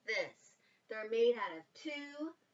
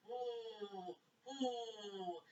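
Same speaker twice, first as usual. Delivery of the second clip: owl hooting; 2 semitones lower, 11 dB quieter than usual. A narrator's reading. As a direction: hoot softly like an owl. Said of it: "the owl said".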